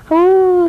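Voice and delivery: high, loud ouch of a pinched dog; pained yelp. Acted. The dog cries out when the crab pinches it.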